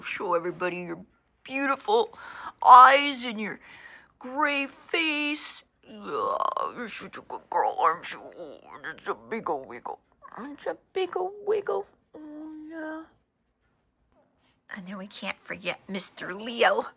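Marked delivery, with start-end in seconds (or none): 0.0-5.6 doting pet talk. Real, not acted. Owner showing off her old dog Mocha, doting pet talk. 5.9-10.0 low, crooning pet talk. Real, not acted. Owner praising her wiggling beagle, low crooning baby talk. 10.4-13.0 squeaky-high cooing to a pet. Real, not acted. Owner fussing over her wiggling beagle, high baby talk.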